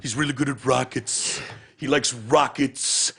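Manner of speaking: lightly voiced president, slow and simple; a hint of a childlike president; imitating the president. Mocking the president on Elon Musk, slow and simple. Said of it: "It sounds like a third grader".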